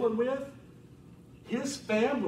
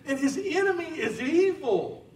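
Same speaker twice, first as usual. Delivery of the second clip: ordinary talk, nothing marked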